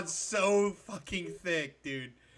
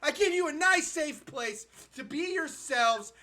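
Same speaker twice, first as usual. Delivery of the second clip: raised-pitch, aggrieved tirade; indignant rant; 6 semitones higher, 5 dB louder than usual. Real, not acted. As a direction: berate the ungrateful audience. Mock tirade at his chat.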